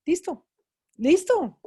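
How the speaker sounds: rising determination, from first listo to a loud final listo; resolve building. Retold or imitated. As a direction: push each listo up, ending loud and high. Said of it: "y dije".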